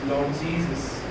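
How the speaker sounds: normal talking voice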